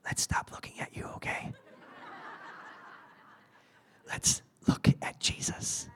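gentle whisper ending in a soft laugh; hushed, affectionate whisper. Acted. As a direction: whisper it kindly, with a little laugh. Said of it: "And he's like".

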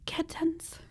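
soft breathy whisper; excited whisper. Real, not acted. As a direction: whisper it with delight. Whispering the word kittens.

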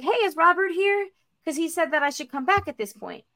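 high-pitched, hopeful inquiry; polite inquiry. Retold or imitated. Traveler asks gate agent for Robert, polite high inquiry.

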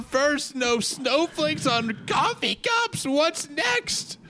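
hammed-up fake sobbing, high and wobbly; a horrendous mock weep, pitch cracking upward; fake cry. Host fake-crying to his co-hosts over Starbucks, hammy sobbing. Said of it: "Your fake cry is horrendous".

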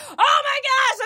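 high-pitched retold gush. Retelling herself to Orrin about his dad, gushing. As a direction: squeal it in mock excitement.

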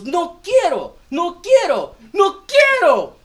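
put-on a child's refusal climbing from loud to a high, slow wail; building child wail. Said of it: "getting progressively louder".

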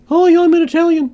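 very high, silly put-on Italian; mock Italian. Joke ad demonstrating accents of different countries, mock Italian in very high voice. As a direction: squeak out a joke Italian introduction. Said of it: "Here's an Italian".

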